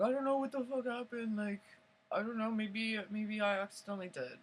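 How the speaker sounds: mildly flustered excuse; retold defensive denial. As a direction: imitate someone fending off blame.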